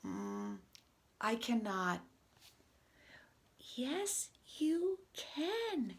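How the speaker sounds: expressive read-aloud with swooping pitch; animated picture-book dialogue. Acted. Reader voices two friends arguing over pretending, animated. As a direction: act out both characters for young children.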